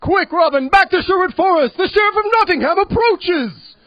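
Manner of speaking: very loud, high hype yelling. Retold or imitated. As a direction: yell it all like a hype man. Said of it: "this is my impression of [name]".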